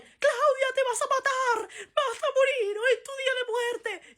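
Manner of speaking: very high, loud hysterical wail; dramatic panicking friend. Retold or imitated. Dramatic friend pulling her, wailing she'll die. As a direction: shriek it like a drama queen sure she'll die.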